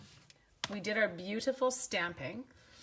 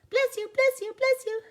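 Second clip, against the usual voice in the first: sing-song blessing; 9 dB louder than usual. Acted. Blessing viewers' sneezes.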